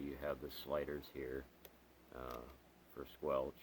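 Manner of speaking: ordinary delivery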